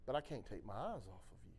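low, very soft adoring murmur; gently insist, almost under the breath; tender reply. Retold or imitated. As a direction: imitate a hushed voice full of love. Voices God's tender reply.

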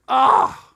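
loud, high frustrated cry; sudden loud exasperated ah; exasperated yell. Retold or imitated. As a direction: let out a fed-up shout. Exasperated outburst at phone call.

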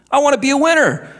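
put-on bright eager kid answering dad; son's eager reply. Son answering his dad, eager and quick.

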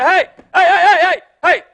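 high, loud mock shout of hey; hammered-up panicky passenger calling through the door; mock yell at the pilot. Passenger yelling at the pilot through the cockpit door, mock loud.

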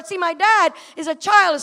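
ordinary delivery

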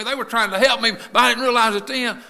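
high, emphatic sermon voice; impassioned preaching. Real, not acted. Preacher on teachers trying to help him, impassioned and high.

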